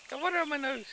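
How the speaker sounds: the cat complaining in a tiny high voice; voicing the cat. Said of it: "He's like".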